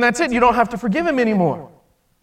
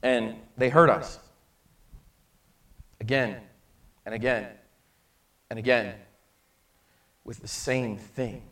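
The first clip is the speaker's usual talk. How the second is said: hushed preaching; 8 semitones lower, 7 dB quieter than usual. Real, not acted. Preacher turning to the congregation, soft and low. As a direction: pull the room in quietly. Quiet sermon climax.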